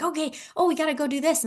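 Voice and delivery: high, hurried excitement; excited rush. Retold or imitated. Podcaster reenacting her own rushed excitement, high-pitched. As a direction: act out being swept up and in a hurry. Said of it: "So even if I've been excited".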